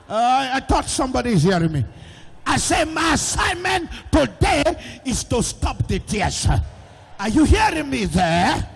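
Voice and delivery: loud, driving sermon voice to the whole hall; fiery preaching. Acted. Preacher calling out to the whole congregation, loud fiery sermon. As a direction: preach it out full force, calling to the gallery.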